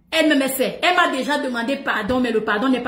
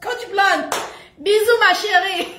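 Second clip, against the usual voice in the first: playful kiss-off; 8 semitones higher, 4 dB louder, slower than usual. Real, not acted. Blowing kisses to Coach Blonde.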